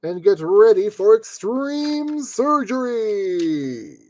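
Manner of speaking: slow, drawn-out hype announcer voice; hammed-up dramatic announcement; mock announcer. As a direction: stretch the last word out like a show announcer. Mock announcement of next step.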